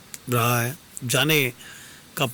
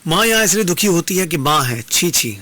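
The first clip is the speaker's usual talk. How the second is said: raised, louder voice of reproach; indignant rebuke; 6 semitones higher, 6 dB louder than usual. Acted. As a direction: rebuke the shameless stranger, pitched up. Woman rebukes the stranger's shameless talk. (तिरस्कार भरी ऊँची आवाज़)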